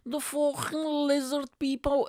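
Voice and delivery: very high, strained drunk mimicry; drunk guy impression. Stranger answering what he is talking about, high drunk voice. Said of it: "And he said".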